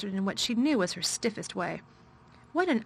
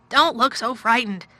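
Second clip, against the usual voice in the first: louder, sharper exclamation; exclaimed; 3 semitones higher, 8 dB louder than usual. A narrator's reading. Guardian to a scared girl, exclaiming. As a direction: blurt it out, exasperated. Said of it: "he exclaimed".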